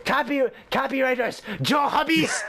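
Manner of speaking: mock-grand Japanese-accented roll call; horrible Japanese accent. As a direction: announce each name, stretched, in a fake Japanese accent. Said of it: "doing a horrible Japanese accent".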